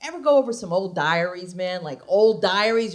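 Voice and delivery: normal talking voice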